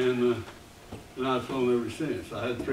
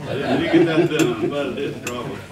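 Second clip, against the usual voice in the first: says it through a real laugh, louder; 9 dB louder than usual. Real, not acted. Chuckling about an old prank plan.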